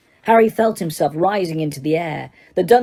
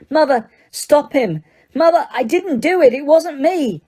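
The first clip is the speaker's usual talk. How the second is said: much higher, frantic begging; desperate plea; 9 semitones higher, 3 dB louder than usual. Acted. Son pleading with his mother, frantic. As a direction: beg her to stop him.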